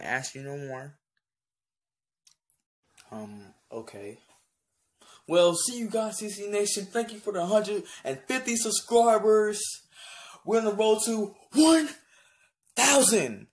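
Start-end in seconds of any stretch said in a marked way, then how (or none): 5.3-13.5 high, shouted celebration; hyped sign-off. Real, not acted. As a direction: holler your thanks to the subscribers. Loud celebratory sign-off to subscribers.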